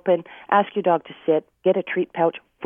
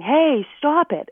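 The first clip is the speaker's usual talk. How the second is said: a high, slow mock yell at the dog; retold yell; 9 semitones higher, 4 dB louder, much slower than usual. Trainer imitating owners yelling at a barking dog, high mock yell. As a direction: shout it at the dog like a fed-up owner. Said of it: "people are yelling".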